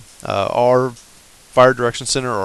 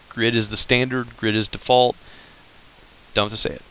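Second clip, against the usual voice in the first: ordinary talk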